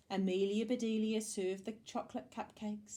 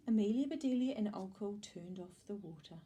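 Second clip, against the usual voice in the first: plain reading voice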